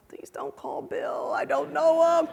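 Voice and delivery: put-on young lawyer panicking at the prosecutor; freaking out. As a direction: beg him not to call in a rising panic. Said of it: "I'm freaking out".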